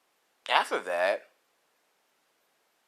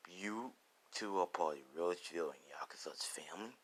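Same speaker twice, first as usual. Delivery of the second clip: low, near-whispered threat; hushed and dangerous, pitched well down; menacing question; 7 semitones lower, 15 dB quieter than usual. Acted. Deku's menacing question to them.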